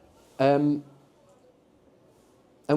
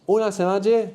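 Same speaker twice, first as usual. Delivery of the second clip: raised, louder questioning tone; puzzled "come again?"; 7 semitones higher, 5 dB louder than usual. Acted. Teacher acting out not understanding somebody, puzzled query. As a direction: query it as if you didn't catch it. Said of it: "you don't understand that".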